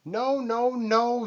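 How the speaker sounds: high screaming no. A narrator's reading. Vulture screams at the idea of Rabbit leading, high. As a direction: shriek it like a shocked bird. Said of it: "screamed Vulture".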